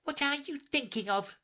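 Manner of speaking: high-pitched scolding mouse; severe. A narrator's reading. Mouse challenging daydreaming Alice, severe and high. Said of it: "said the mouse to Alice severely".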